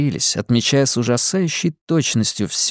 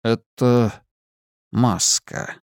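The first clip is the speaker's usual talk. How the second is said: mysterious man; much slower than usual. Acted. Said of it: "сказал Лазарус".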